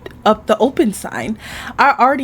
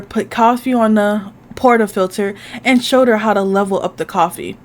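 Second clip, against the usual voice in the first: normal talking voice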